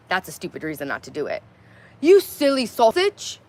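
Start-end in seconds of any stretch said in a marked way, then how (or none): 2.0-3.4 slow, loud, higher-pitched attempt at a British accent. Shown as a demonstration. Streamer attempting a requested British accent for chat, self-consciously hammed up. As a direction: put on an awkward British accent, drawn out. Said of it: "in a British ac".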